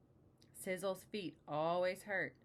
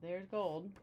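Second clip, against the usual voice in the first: usual speaking voice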